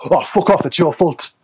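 high, indignant Geordie put-on; Geordie accent. Coach mimicking a dismissive coach to a client, Geordie accent. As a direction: snap it back in a mock Geordie voice. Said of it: "I don't know why I always go Geordie".

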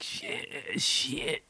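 strained mock choking curse; about to choke. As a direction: curse as if the smoke has your throat. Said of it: "about to fucking start choking".

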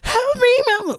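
mock hysterical scream. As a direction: shriek it like a frantic caller, hammed-up. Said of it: "screaming like a crazy ass motherfucker".

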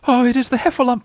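high, anxious little Piglet; nervous high-pitched squeak of dread. A narrator's reading. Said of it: "thought Piglet nervously".

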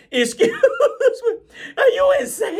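God laughing at a presumptuous sinner; laughing incredulous God. Acted. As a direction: cackle through it, amazed at the nerve. God voiced, mocking a sinner.

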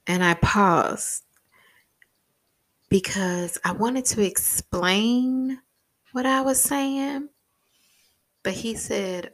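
ordinary delivery

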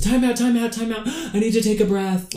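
put-on high, loud, breathless kid pleading for time out; whiny kid. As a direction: squeal it like an obnoxious kid gasping. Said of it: "he goes".

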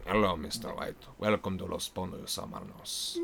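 low, quiet, measured villain greeting; Gus Fring. Acted. Actor spoofing a TV villain's lines for the host, hushed impression. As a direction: softly impersonate a menacing, polite boss. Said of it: "instead of Gus Fring talking".

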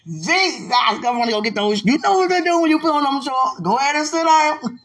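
very high, loud, giddy squeal; playful frenzy. Pretend. Telling sweetheart about those shorts, playfully overexcited. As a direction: push the teasing up into a high yell.

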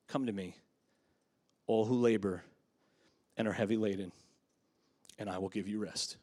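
soft, low scripture reading; gentle invitation. Acted. Preacher reading Jesus' invitation to the weary, gentle and hushed. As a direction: offer it tenderly, pausing after each phrase.

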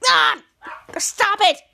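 high, loud yelp of protest; mock pained shout. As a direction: cry out as the zap hits. Zapped on the forehead.

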